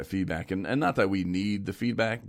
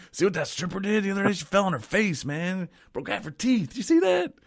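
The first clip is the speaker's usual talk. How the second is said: hammed-up radio banter; 12 semitones higher than usual. DJ mocking his own awkward small talk with college athletes, hammed-up excitement. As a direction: blurt it out in a mock-excited pitch.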